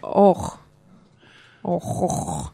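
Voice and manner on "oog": oog with a guttural g; Dutch oog. Shown as a demonstration. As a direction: oog with a throaty Dutch g at the end. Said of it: "In Dutch".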